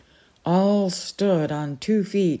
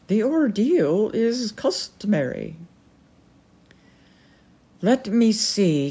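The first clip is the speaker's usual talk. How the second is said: haughty falcon lady; 4 semitones higher than usual. Acted. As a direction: quiz him like a stern noblewoman. The Peregrine speaks.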